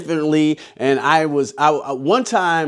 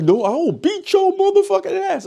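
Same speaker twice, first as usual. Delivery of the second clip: mock threat in a much higher voice; tough girl squaring up. Teller imitates a girl threatening a scare actor: high mock threat. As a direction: play the girl ready to swing, voice pitched way up. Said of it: "girls square up like this".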